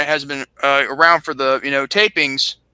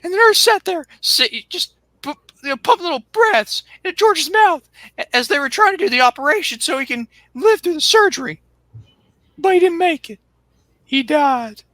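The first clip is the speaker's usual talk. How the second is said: high, squeaky Cornette voice; Cornette impression; 11 semitones higher than usual. Bruce's friend on the phone, in a high squeaky whine. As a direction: squeal it out like a frantic southern manager.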